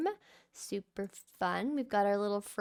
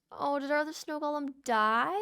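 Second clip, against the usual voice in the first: normal talking voice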